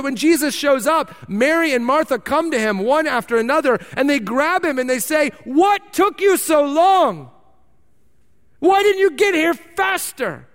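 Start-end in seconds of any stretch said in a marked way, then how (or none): 5.4-10.5 grieving sisters' reproach. Retold or imitated. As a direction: cry out at him, hurt and demanding. Grieving sisters confront Jesus.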